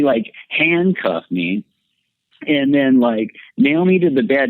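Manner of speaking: ordinary delivery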